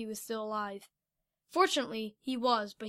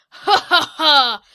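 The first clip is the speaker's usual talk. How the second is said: slow, loud evil laugh in a put-on voice; horrible villain laugh; 4 semitones higher, 16 dB louder, much slower than usual. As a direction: cackle as a gloating monster. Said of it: "in a horrible voice".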